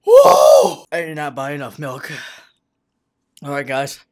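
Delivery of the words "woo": high, loud "woo"; loud pained whoop. Real, not acted. Man short of milk with burning mouth, pained whoop. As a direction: whoop sharply at the burn. Exhaling from the burn.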